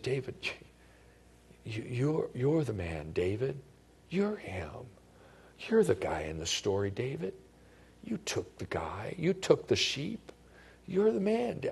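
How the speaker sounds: soft, broken-hearted confrontation; a grieving friend delivering hard news; tearful. Shown as a demonstration. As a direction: say it gently through tears. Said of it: "were tears coming down his face when he said".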